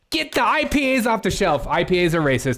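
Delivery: high, hammed-up indignant order; mock outrage. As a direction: play the scandalized activist. Mocked activist ordering IPAs removed.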